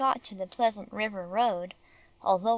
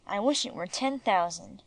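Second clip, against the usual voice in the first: ordinary delivery, nothing marked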